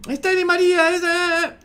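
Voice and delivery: very high, loud match call; high-pitched commentary. Shown as a demonstration. Imitating Clos as the play nears goal, high commentary. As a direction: hold the call up at the top of the range. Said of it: "ahí está en agudo".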